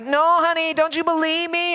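high, put-on pleading voice of Mary; lightly voiced hurt bride. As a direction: plead with him like a hurt fiancée.

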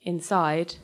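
plain reading voice

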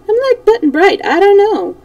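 high, loud, jolly delivery; amused, near laughing. A narrator's reading. Shaggy Man admits to Dorothy he's lost too, amused. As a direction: toss it off merrily, pitched right up. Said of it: "answered the Shaggy Man with a laugh".

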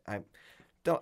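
usual speaking voice

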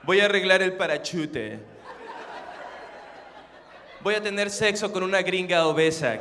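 low, relaxed, well-articulated husband voice; mock-suave man addressing his love; cool, composed partner. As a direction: speak cool and unbothered, every word in place. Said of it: "así todo fresco con tu pareja".

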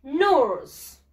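"Nurse" loud and drawn out, falling; model pronunciation. Shown as a demonstration. Teacher giving pupils a word to repeat, "Nurse" drawn out loudly. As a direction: model "Nurse" as one long English syllable, loudly.

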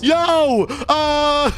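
high sudden yelp; startled yell. Real, not acted. Gamer hit by the hose, startled yelp. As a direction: yelp as the water hits. Sprayed with water.

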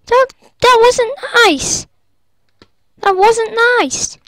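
high, loud hurt complaint; indignant whine. Real, not acted. Complaining about the griefer.

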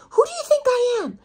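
very high, loud indignant cry; furious confrontation. Retold or imitated. Agent demanding an answer from the model, furious confrontation. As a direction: shoot way up in pitch, outraged. Agent repeats question, much higher, louder.

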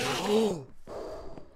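high, sharp gasp of alarm; frightened gasp. Real, not acted. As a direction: gasp in fright while fleeing. Cow will not help him.

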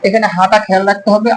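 ordinary talk

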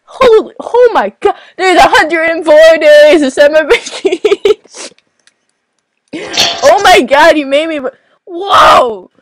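giddy outburst; excited shout. Real, not acted. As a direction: yell it with delight. Excited at a hundred and four days.

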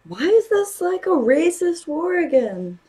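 can't believe what you're seeing; shocked disbelief. Real, not acted. Shocked reaction to the show.

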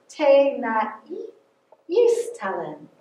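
Te na i istalen sounded out slowly, each syllable apart. Shown as a demonstration. Teacher sounding out Te na i istalen slowly for children. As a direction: break Te na i istalen into slow syllables for the class. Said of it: "we're gonna sound it out with the syllables".

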